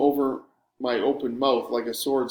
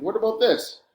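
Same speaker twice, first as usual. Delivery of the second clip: excited; 9 semitones higher than usual. A narrator's reading. Excited retort, much higher.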